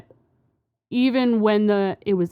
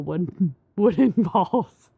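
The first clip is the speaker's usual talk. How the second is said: laughing; slower than usual. Real, not acted. Giggling while explaining.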